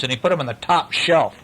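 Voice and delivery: usual speaking voice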